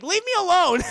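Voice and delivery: high, loud pleading cry; mock shriek. Yukina shrieking at Kuwabara to leave her alone, high mock shriek. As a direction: shriek it at him, hammed-up. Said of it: "And she's like".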